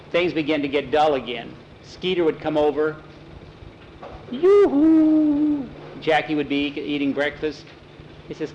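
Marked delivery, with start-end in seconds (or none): 4.3-5.7 high, loud sing-out hail; calling out. A narrator's reading. Skeeter arrives at Jackie's house, high call. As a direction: holler a long high greeting. Skeeter comes over and calls.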